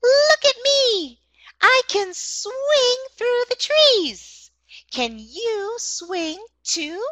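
high-pitched, drawn-out monkey voice; sing-song storybook monkey, slow and high; boastful monkey. Acted. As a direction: stretch it out high like a show-off monkey in a children's story. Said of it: "Monkey says".

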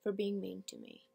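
soft, drained reproach; monologue character, wounded. Acted. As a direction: let the accusation sink to near silence.

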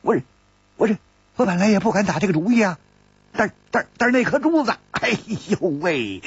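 high, flustered stammer; wry, flustered Zhang San. Acted. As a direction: babble your excuses with a wry groan. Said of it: "张三苦笑着说".

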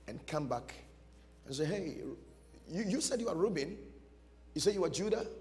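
ordinary talk, nothing marked

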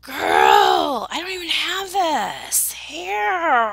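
loud, drawn-out mock wail; hammed-up envy, stretching the last word. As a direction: wail it in playful despair. Mock despair over someone's hair.

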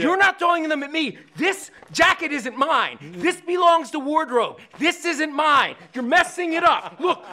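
loud, high, punched-out complaint; acted-out angry scolding; indignant rant. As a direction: berate him over the ruined jacket. Retold star yells about his jacket.

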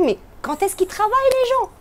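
high-pitched, incredulous question; mock puzzlement. Onlooker wondering about packed café terraces, high mock puzzlement. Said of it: "On se dit".

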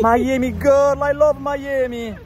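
high, delighted gushing; gushing excited. Real, not acted. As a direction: sing it out with delight. Gushing over Miami girl.

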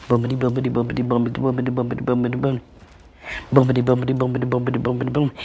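soft, even chant of bumpity; tricycle sound. Retold or imitated. Teller imitating her quiet tricycle, soft rhythmic chant. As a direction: lull the tricycle noise quietly. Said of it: "The tricycle sounded like".

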